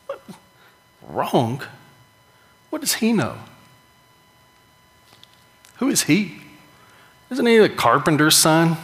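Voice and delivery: scoffing religious elders, pitch jumping up in disbelief; mock outraged Pharisee, lightly voiced; indignant Pharisees. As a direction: scoff like an offended scholar.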